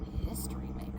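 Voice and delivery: echoing whispered history maker. Shown as a demonstration. Reader repeats the phrase to children, whispered. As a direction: breathe it out as a whisper.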